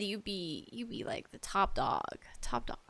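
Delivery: ordinary delivery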